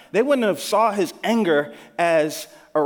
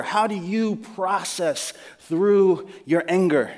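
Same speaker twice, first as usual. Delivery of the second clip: usual speaking voice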